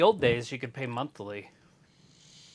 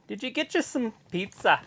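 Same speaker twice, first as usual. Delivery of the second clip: mock Irish accent; 6 semitones higher than usual. Shown as a demonstration. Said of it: "saying English words with the accent".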